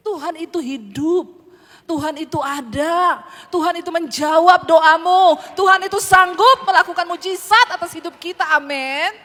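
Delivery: loud, ringing proclamation, each line hammered; preacher declaring to the congregation, shouting with conviction; preaching at full force. Acted.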